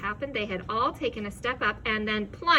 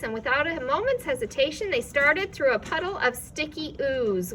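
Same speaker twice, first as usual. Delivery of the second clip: ordinary delivery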